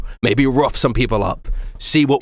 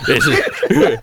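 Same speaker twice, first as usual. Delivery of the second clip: normal talking voice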